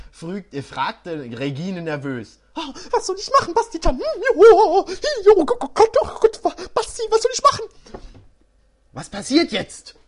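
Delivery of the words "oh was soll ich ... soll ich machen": much higher and louder, laughing through it; hammed-up panic. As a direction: overplay the girl's panic while cracking up. Exaggerated Regine, laughing.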